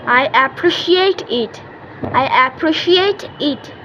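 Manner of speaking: each phrase drawn out very slowly; slow drill. Shown as a demonstration. Teacher drilling thank-you phrases for kids, very slow. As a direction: say it slowly so kids can copy.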